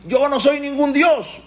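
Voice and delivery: high, stretched-out retold cry; forced shouting. Paró made to renounce being a god, high imitated shout. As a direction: proclaim it in a high yell. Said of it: "Y tuvo que gritar".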